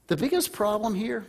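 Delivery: ordinary talk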